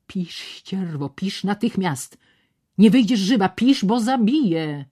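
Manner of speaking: controlled menace rising in volume and pitch; savage calm. A narrator's reading. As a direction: draw the words out, then press harder. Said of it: "mówił z dzikim spokojem".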